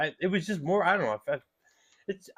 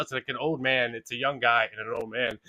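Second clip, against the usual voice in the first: ordinary talk, nothing marked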